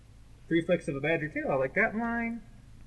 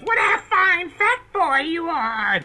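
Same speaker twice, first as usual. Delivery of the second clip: high, loud, slow witch cackle; 13 dB louder, much slower than usual. Retold or imitated. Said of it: "when the witch goes".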